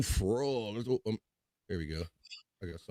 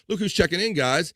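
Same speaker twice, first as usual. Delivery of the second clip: excited greeting; 10 semitones higher, 5 dB louder than usual. Real, not acted. Greeting a viewer checking in.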